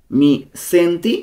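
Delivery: slow pronunciation model. Shown as a demonstration. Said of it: "উচ্চারণগুলো ভালো মতো খেয়াল করব".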